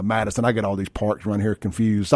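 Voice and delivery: normal talking voice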